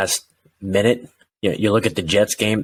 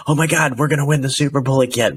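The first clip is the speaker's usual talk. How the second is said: imitating giddy fans, pitched up and fast; mock fan hype; 6 semitones higher, faster than usual. Fans dreaming of the Super Bowl, mock hype. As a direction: ham up the fan's overexcitement. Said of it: "You guys think".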